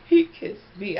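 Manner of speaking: loud, high exclaim; loud giddy squeal of news; excited. Real, not acted. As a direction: burst it out, thrilled. Excited retelling of kiss.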